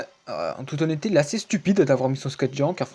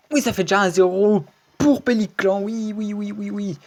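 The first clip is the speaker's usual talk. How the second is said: high, loud victory shout; high celebratory yell trailing into repeated cries; excited cheer; 7 semitones higher, 6 dB louder than usual. Real, not acted. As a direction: cheer the point like a commentator. Celebrates a point scored.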